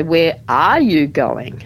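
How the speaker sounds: "are" stretched and lifted above the other words; slow demo question weighted on "are"; stress on ARE. Shown as a demonstration. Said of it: "we might stress certain words".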